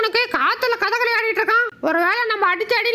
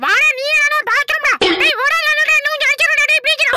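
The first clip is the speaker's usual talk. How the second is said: higher, raised voice in a comic scuffle; frantic scuffle yelling; 4 semitones higher, 4 dB louder than usual. Acted. Dubbed character pleading mid-beating, frantic yelling. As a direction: yell and plead mid-fight.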